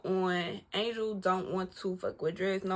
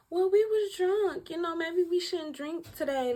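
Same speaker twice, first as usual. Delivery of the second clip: high, timid excuse voice; high gullible self-quote; naive self; 9 semitones higher than usual. Retold or imitated. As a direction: squeak it out gullibly.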